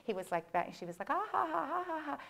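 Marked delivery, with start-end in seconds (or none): 1.1-2.2 high, imitated head-back laugh; retold laugh. The seatmate laughing at him, imitated high cackle. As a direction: mimic a woman who can't stop laughing. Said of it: "she started laughing in that kind of way".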